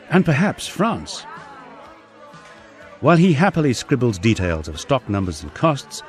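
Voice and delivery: ordinary delivery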